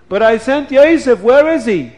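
high, loud alarmed cry; alarmed father. Acted. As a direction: voice rising in alarm. Yaakov alarmed.

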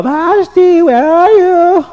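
very high, loud, drawn-out call; calling out the window. Acted. King calls out the window for Vashti, very high and loud. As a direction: shout for her across the garden.

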